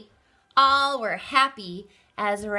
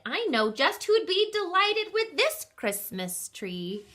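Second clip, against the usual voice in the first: bright, higher voice for Baxter; Baxter's delighted voice; 8 semitones higher than usual. Acted. Baxter showing off the tree, lifted and pleased. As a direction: pitch up, pleased with the find.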